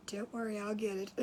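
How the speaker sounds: low, soft reassurance; hushed and soothing; quiet reassurance. Retold or imitated. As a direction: say it gently under your breath. Reassuring startled pet, retold.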